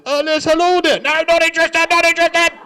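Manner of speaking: loud, high-pitched flustered phone yell; hammed-up irate elderly dad; dad brushing off caller. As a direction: shout down a cold caller. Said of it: "My dad thinks it's a call centre".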